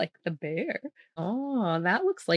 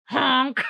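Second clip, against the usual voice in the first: put-on baby honk; 6 semitones higher, 7 dB louder than usual. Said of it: "the baby's like".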